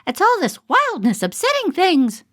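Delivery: a prim mother fussing over wildness; fretting mother. Acted. Janie's mother complaining at the town meeting, high and fussy. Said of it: "Janie's mother said".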